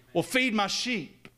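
urgent preacher's plea. Acted. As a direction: raise the pitch and draw out feed. Building Jesus dialogue.

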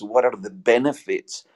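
ordinary delivery

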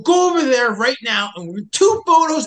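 bossing a stubborn hiker through a photo; barked order. Retold or imitated. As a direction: order him to move, now.

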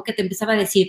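ordinary talk

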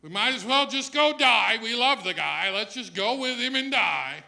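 hammed-up fatalism; fatalistic. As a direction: play a doomed but loyal disciple. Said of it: "that sort of fatalistic view".

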